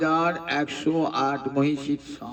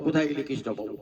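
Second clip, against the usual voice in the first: softer, lower echo of কোথায় গেলে কৃষ্ণ পাবো; quiet echo; 4 semitones lower, 7 dB quieter, faster than usual. Acted. The wandering sage repeats his search to himself, softer and lower. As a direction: let the refrain fall away to a hush. Sage's searching refrain, echoed.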